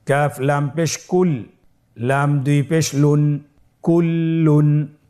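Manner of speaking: slow, measured recitation with held vowels; Quranic spelling drill. Shown as a demonstration. Teacher spelling Quran words for learners, slow drill recitation.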